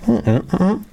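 humming the tune of the question; short hummed syllables; hummed intonation. Shown as a demonstration. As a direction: hum the sentence melody without words. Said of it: "Con entonación".

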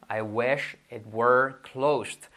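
very slow, drawn-out English example; slow model sentence. Shown as a demonstration. Teacher models English example for learners, slowly. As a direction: spell out each word unhurriedly.

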